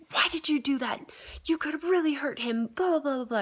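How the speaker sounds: high, loud scolding dad's voice; angry yelling dad. Retold or imitated. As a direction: scold like a furious father whose toddler just fell. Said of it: "just yelling at me and really mad".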